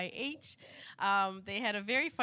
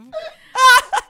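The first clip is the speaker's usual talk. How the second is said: shrieking high burst of laughter; real high laughing shriek; loud laugh; 20 dB louder than usual. Real, not acted. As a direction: crack up hard.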